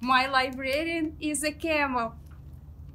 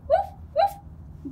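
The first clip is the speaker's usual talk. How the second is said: high puppy yip; 13 semitones higher than usual. Pretend. Voicing the dog Biscuit.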